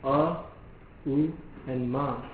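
slow, separate syllables A, U, Ma; sounding out the three parts of Om for students; syllables spelled out slowly. Shown as a demonstration. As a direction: lay out A, U and Ma one by one, slowly. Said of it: "The three syllables of Om".

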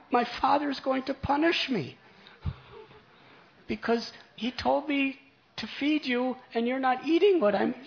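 high, loud tearful pleading; a little boy sobbing out his fears; crying child. Acted. Boy weeps before the altar, begging Gopinath to eat, high and loud. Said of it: "Then he began to cry".

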